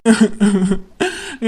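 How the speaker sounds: rhythmic tearful sobs; sobbing. Acted. Asked what happened, can only sob. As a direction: sob before you can answer. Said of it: "அழாதடி".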